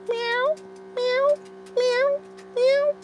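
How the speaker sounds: squeaky falsetto meow; high cat meow. Pretend. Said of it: "meowing to call the cat".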